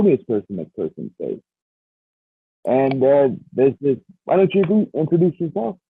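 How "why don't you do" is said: ordinary talk, nothing marked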